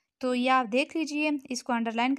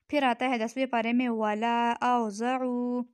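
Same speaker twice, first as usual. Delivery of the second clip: usual speaking voice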